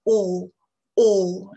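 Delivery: "O" long, rounded, far back. Shown as a demonstration. Teacher showing learners the back O, loud. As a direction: round the lips, hold the back O. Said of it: "très à l'arrière de la bouche".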